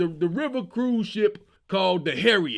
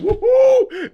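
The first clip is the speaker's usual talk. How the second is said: wild whoop; 14 semitones higher, 10 dB louder than usual. Retold or imitated. Co-captain throws hat, charges in.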